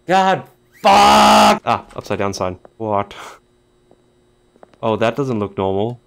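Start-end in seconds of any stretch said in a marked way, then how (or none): 0.1-1.6 very loud, high shout of "God, fuck!"; furious curse. Real, not acted. Gamer fails the run, loud furious shout. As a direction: explode in frustration. Startled outburst.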